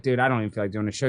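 tired friend calling to cancel a show, dejected; low, fast, dejected mumble. Retold or imitated. Retelling his own weary call.

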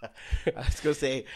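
normal talking voice

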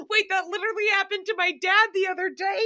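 high, fast, through laughter; laughing realisation. Real, not acted. Remembering dad's similar accident mid-laugh, laughing. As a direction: blurt it out while cracking up.

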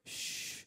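unvoiced shushing hiss; mock shush. Preacher mocking timid believers, a hushing shh. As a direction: hush them like a nervous coworker.

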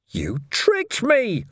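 high, drawn-out wail; betrayed, stretched-out cry; anguished wail. A narrator's reading. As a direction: wail the accusation slowly and high. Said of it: "Ferret wailed".

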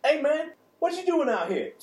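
high, loud street hustler. Acted. Street character in improv scene.

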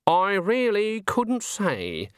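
high, slow and haughty. A narrator's reading. Granny declining to comment to Nanny, haughty. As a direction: draw it out loftily, pitched high. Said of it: "said Granny haughtily".

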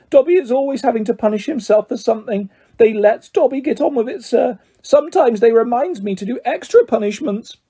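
high, dutiful house elf; Dobby, humble. A narrator's reading. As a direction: servile little creature, pitched high.

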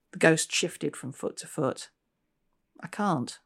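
measured, storytelling read-aloud voice; story narration. A narrator's reading. Author reading her novel aloud to an audience. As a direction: draw listeners into the haunted station.

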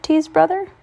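plain reading voice

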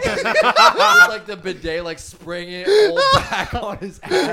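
slow, loud laughing through words. Real, not acted. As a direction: crack up while telling it. Laughing while telling.

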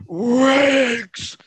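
high, drawn-out commentator wail; mimicked commentary. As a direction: stretch it out slowly in a very high cry.

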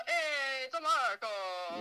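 hammed-up mock Italian accent. Imagining Marco Polo greeting someone, mock Italian accent. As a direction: call out in a playful Italian accent. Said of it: "he has an Italian accent".

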